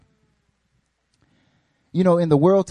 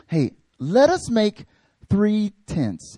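higher, slow, drawn-out offer; slow earnest proposal; 5 semitones higher, much slower than usual. Retold or imitated. Peter proposing tents, slow eager offer. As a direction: present it like a bright idea. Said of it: "He says".

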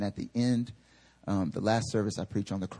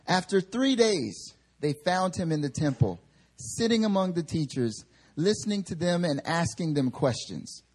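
higher, measured reading voice; scripture reading; 6 semitones higher than usual. Acted. Preacher reading Luke aloud to the church, measured reading. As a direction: read the passage to the congregation.

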